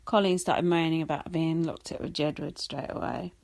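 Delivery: ordinary talk, nothing marked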